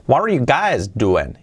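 ordinary delivery

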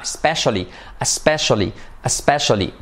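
especially with the first E almost an A; especially, first vowel wide like A; open e in especially. Shown as a demonstration. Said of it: "E tan abierta que casi es como una A".

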